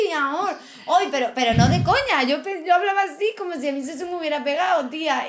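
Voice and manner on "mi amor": put-on higher, playful Cuban accent; mock the Cuban twang you picked up in Havana; lightly put-on Cuban lilt. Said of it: "Yo todo el rato estaba así".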